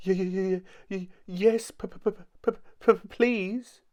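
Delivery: high, squeaky stutter on each word; quivering stammer. A narrator's reading. Mister Jelly asks for the secret, quivering stammer. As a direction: stutter it out, shaking with nerves. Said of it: "quivered and shook and said".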